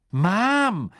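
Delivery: high, loud exasperated call; irritated. A narrator's reading. Ron to his secretive mother, irritated. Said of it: "sagte Ron gereizt".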